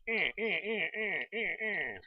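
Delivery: high, repeated "eh, eh" grunts; mock stuck-mob noises. Player mimicking mobs stuck in his trap, mock grunts. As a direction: imitate a stuck creature straining, pitched high.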